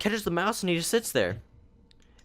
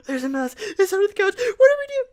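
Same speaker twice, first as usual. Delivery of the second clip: very high, loud frantic mom; hammed-up hysterical squeal; mock panic; 7 dB louder than usual. Acted freaked-out mom in story.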